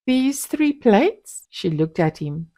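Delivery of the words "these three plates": slow, higher sly question; malicious. A narrator's reading. Wife answering her husband slowly with a malicious smile. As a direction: savour the words with a smile. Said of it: "a malicious smile on her lips".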